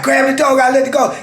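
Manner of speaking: high, insistent retold plea; acted-out urgent call; urgent demand. Bargaining while holding the dog.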